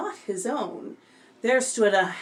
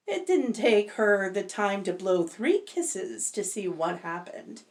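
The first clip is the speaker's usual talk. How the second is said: ordinary delivery, nothing marked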